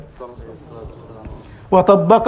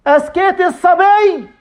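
higher, louder call to the mother; 8 semitones higher, 3 dB louder than usual. Acted. Umar calling to the mother through the door, raised voice. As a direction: call across to her, pleading and loud. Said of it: "قال عمر".

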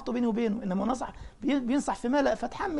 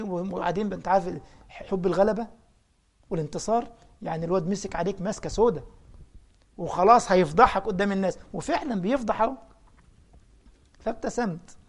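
ordinary delivery

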